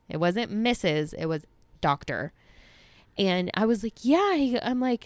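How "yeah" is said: high, excited yelp of agreement; retold excitement, pitched up; excited reply.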